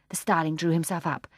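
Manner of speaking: ordinary delivery, nothing marked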